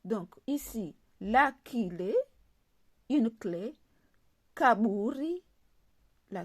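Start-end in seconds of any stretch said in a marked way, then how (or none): none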